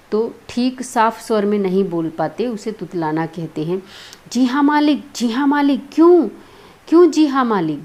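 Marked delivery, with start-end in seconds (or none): 4.3-7.8 higher, louder mocking echo, then a sharp demand; indignant acted outburst; angry. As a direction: throw the words back in exasperation. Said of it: "गुस्से में वो Julia से".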